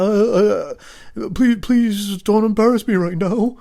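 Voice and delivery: high, loud, slow begging; stammer, then drag out a pained plea; embarrassed pleading. Retold or imitated. Son begs mom on the line, embarrassed high pleading.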